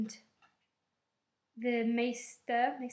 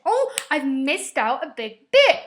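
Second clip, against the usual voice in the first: loud, raised-pitch, slowed exclamation; sudden realisation; 4 semitones higher, 13 dB louder, slower than usual. Real, not acted. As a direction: blurt it as you remember, stretching it out. Suddenly remembers a forgotten part.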